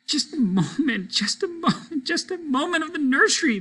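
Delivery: put-on high, rising child's wail; wailing plea. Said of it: "they wailed".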